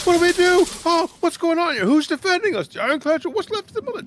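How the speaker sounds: very high, loud panicked villagers; scared townsfolk shouting questions at the mayor; panicked crowd. Acted. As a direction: shriek the questions in a frenzy. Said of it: "questions and scream".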